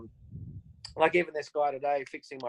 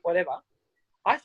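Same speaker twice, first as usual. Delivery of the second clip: normal talking voice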